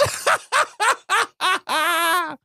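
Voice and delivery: high maniacal villain laugh; put-on evil cackle in a long staccato run; Joker cackle. Podcaster capping the synopsis for co-host, mad Joker cackle. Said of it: "do it in my Joker voice".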